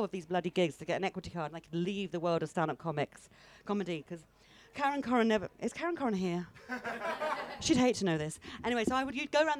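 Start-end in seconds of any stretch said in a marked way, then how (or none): none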